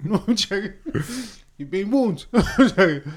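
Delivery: high, laughing "just joking". Real, not acted. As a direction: laugh through the words. Teasing then laughing it off.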